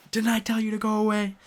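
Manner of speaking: high, loud, fast mock yell; angry yell. Nami yelling at Luffy to leave, high and loud. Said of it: "And she's super mad at him".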